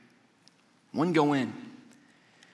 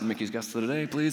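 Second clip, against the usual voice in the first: low, softened aside; mock discreet request; 6 semitones lower, 6 dB quieter than usual. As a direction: pretend to slip someone a quiet bribe. Imagined discreet bribe request.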